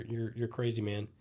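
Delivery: ordinary delivery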